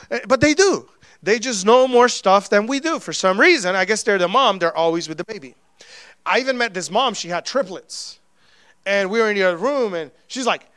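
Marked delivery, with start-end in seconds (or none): none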